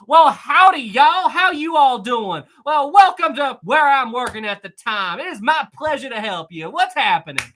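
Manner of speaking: over-the-top Southern welcome to mess with people; exaggerated Southern accent. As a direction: turn the Southern twang up to a thousand. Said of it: "I turn it up to a thousand".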